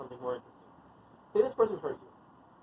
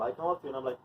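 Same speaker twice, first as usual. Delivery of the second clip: ordinary delivery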